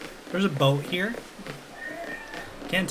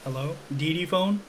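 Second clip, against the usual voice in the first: usual speaking voice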